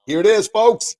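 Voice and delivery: bursting out high and loud with the reveal; excited reveal. Real, not acted. Host holding up his book for live viewers, excited. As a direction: show it off like a proud unveiling.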